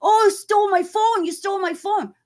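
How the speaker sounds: high-pitched mock scream of you stole my phone; imitating a guy playing the victim, high and loud. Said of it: "he starts screaming".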